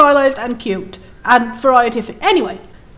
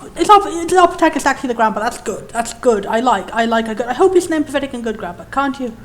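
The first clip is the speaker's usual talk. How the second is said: ordinary talk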